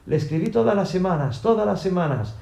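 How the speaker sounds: high, emphatic protest; insistent shout. A narrator's reading. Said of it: "Y madre gritó".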